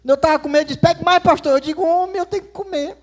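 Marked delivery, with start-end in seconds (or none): 0.8-1.5 higher and louder urging to take more; pushy insistence. Retold or imitated. Gildo urging the pastor to eat more, louder and higher. 1.8-3.0 mock protest. Pastor protesting the overloaded plate.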